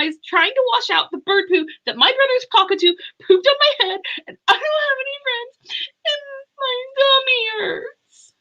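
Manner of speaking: mock crying. Little boy Sam listing why he's crying, in mock tears. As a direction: blurt it out, pretend sobbing. Sam's crying list voiced.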